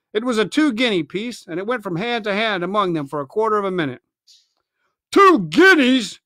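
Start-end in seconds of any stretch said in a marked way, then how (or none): 5.1-6.2 slow, higher furious roar. Acted. Angry pirate roaring at his captain. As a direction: bellow it with rage. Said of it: "roared Merry".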